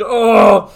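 loud, high mock wail; mock crying wail. Describing the cellmate in tears, loud imitated wail. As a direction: wail in cartoon despair. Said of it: "crying and holding his head".

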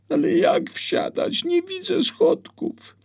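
put-on high, loud frightened child voice; a small boy scared of the foal; scared little boy. Scared boy telling mama the foal is kicking, high and frightened.

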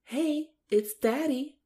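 mock doting dad. Friend mocks Shane greeting his baby, high sing-song baby talk. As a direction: coo at the baby in a hammed-up daddy voice. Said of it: "he's like".

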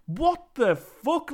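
slow, rising, shocked double take; stunned disbelief. Retold or imitated. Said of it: "I just sat up at that point".

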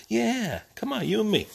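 high-pitched happy pet talk; high affectionate dog voice; doting coaxing. Real, not acted. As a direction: coax your dog along in a high, falling lilt.

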